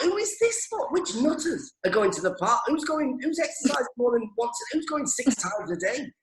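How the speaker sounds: high, incredulous mock outrage. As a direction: squawk it out in disbelief. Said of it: "And I thought".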